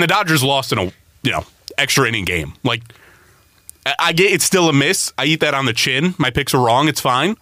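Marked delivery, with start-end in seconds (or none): none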